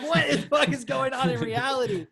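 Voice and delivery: loud, high-pitched retold disbelief; incredulous outburst. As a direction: relive being totally floored, voice shooting up. Retold shock at conspiracy claims.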